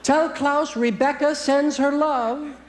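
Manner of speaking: high, slow, sugary friendly voice; lilting high voice passing along love; sweet parting friend. Retold or imitated. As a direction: coo it like a doting friend. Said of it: "Like they say".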